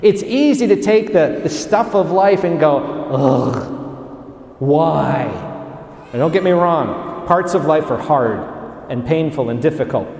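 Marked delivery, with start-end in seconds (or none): 2.8-5.2 low, put-upon groan of complaint; mock grumbling. Preacher mimics people grousing about life, mock grumbling. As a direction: grumble it with a sigh of self-pity. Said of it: "complaining, whining, grousing, murmuring".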